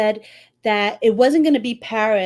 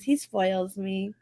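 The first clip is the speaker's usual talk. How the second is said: ordinary delivery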